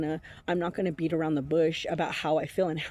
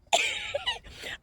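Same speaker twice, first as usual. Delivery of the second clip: laugh; 3 dB quieter than usual. Real, not acted. Laughing at his excuse.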